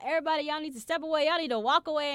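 loud, fast guard's order; bossy order. Retold or imitated. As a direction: bark it like a bouncer clearing a crowd. Security guards ordering crowd away.

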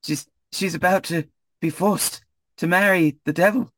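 high, winded, broken phrases; puffing between words, pretending to run; out of breath. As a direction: gasp the bad news out while jogging. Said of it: "she starts getting a bit out of breath".

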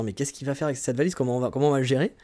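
usual speaking voice

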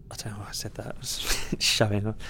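sheepish whispered confession; whispered self-mocking aside. Real, not acted. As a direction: drop to a breathy whisper, half laughing. Embarrassed aside after bragging.

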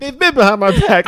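high, loud, indignant protest; mock defensive protest. Husband defending himself to Katie, loud high protest. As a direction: squeal out the innocent defence.

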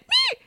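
squeaky high meow; a tiny mock meow way up high; high-pitched cat-like mew; mouse meow. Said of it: "This mouse went".

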